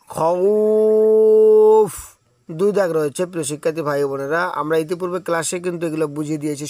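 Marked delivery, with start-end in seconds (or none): 0.1-1.9 খউফ with the vowel stretched very long and louder; long drawn madd. Shown as a demonstration. Teacher drawing out খউফ for the students. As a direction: hold the খউফ out for two alifs. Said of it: "দুই আলিফ টানতে হবে".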